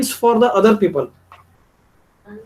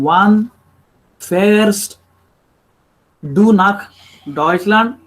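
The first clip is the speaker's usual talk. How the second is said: "Wann... fährst... du nach... Deutschland", slow with long gaps; halting word-by-word; much slower than usual. Shown as a demonstration. Teacher showing how not to speak with Germans, slow and halting. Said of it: "You cannot, uh, speak with them".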